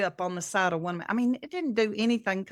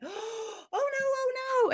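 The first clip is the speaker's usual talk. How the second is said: much higher, alarmed oh no; panicked dismay. Retold or imitated. Friend sees hand slammed in door, high alarmed cry. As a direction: squeal it out like you just hurt a friend. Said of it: "and she went".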